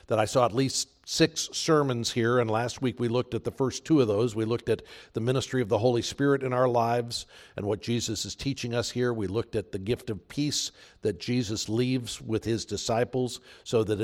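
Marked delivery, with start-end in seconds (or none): none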